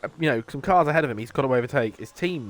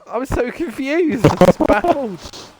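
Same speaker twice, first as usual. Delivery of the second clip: laughing; 12 semitones higher, 7 dB louder, slower than usual. Real, not acted. Laughing at a baffling driving mistake.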